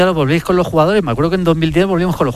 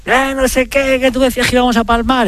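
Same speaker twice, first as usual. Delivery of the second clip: mocking player voice; 9 semitones higher than usual. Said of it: "y hubo uno".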